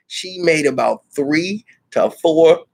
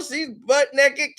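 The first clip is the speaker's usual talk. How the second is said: ordinary talk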